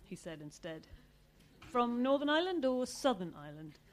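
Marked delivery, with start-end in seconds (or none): 1.7-3.8 probing father. A narrator's reading. Girlfriend's father questions suitor.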